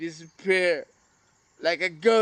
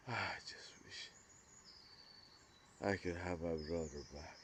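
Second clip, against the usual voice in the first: breathless whisper, much softer; whispered wish; 8 semitones lower, 18 dB quieter, faster than usual. Real, not acted. Missing his brother, he whispers the wish to himself. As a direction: barely voice it, just breath.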